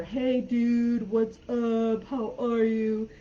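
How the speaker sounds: slow, drawn-out, laid-back surfer dude; Californian surfer. Acted. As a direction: do a relaxed surfer stretching out every word. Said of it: "imagine, like, a surfer".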